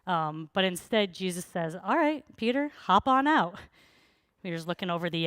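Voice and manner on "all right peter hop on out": raised, higher call across the water. Retold or imitated. Jesus inviting Peter out of the boat, a raised call. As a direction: call him out of the boat, lightly voiced Jesus.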